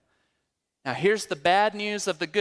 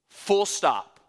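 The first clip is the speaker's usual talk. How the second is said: normal talking voice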